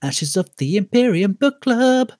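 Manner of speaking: high, slow grand announcement; announcer voice. Pretend. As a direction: present it like a show title. Announcing mock title.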